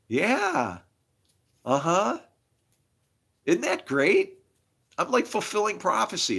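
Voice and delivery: high-pitched mock delight; sarcastic. Real, not acted. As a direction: feign being thrilled, with bitter irony. Bitter irony about being arrested.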